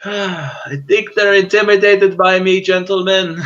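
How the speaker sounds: high, slow, drawn-out boast; slow, lofty high-pitched swagger; in-character gloating. Acted. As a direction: gloat grandly to your companions. Player gloats in character at missed attacks.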